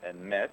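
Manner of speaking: EMET with its final syllable stressed, e-MET; stress on second syllable. Shown as a demonstration. Speaker repeats the corrected Hebrew word, second-syllable stress. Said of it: "with the accent on the second syllable".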